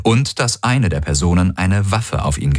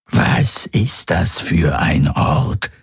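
creature voice; much slower than usual. Acted. Monster asks, slow eerie voice.

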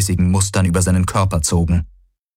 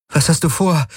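higher-pitched, mildly worried question; 8 semitones higher than usual. A narrator's reading. Kirai asking Galen his plan, worried. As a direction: ask it high, with a touch of worry. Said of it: "hörte er Kirai besorgt fragen".

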